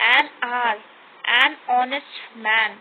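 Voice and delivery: "an hour", "an honest man" with no h sound, said as "aa-our" and "onest"; silent h. Shown as a demonstration. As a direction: drop the h fully in hour and honest, slowly. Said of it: "हम इसको हॉनेस्ट नहीं बोलते ना".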